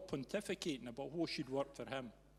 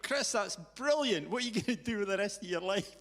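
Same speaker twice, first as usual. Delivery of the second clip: hammed-up delight; 7 semitones higher than usual. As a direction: stretch out brilliant like a proud mentor. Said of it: "And I went".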